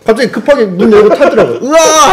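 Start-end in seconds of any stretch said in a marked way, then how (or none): 1.6-2.1 roar. Retold or imitated. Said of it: "하다가".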